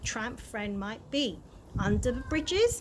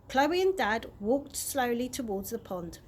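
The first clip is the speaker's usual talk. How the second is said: usual speaking voice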